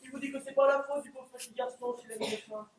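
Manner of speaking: ordinary talk, nothing marked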